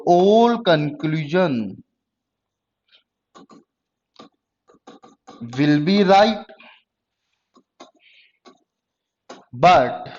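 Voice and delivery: drawn-out words with long gaps for writing; slow dictation. Real, not acted. Teacher gives the class a rule on conclusions, slow dictation. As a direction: dictate the rule slowly, piece by piece.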